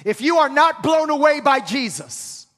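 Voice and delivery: loud, high preacher build; full-force preaching. Acted. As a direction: drive it home to the congregation.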